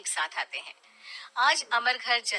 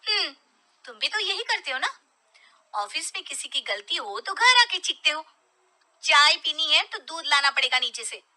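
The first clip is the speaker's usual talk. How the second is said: a touch higher, taunting wife; lecturing wife; 6 semitones higher, 5 dB louder than usual. Acted. Wife taunting husband about shouting at home, raised. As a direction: throw his own habit back at him.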